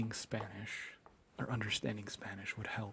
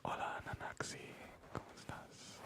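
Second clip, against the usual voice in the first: soft unvoiced whisper; ASMR whisper; 11 dB quieter than usual. Real, not acted. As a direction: breathe it to the viewer. Whispered lines to chat.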